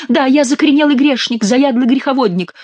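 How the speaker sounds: high, hammed-up penitent whine; high mock-pleading gabble; nasal mock confession. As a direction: confess shrilly through the nose as the fake king. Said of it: "зачастил Шико".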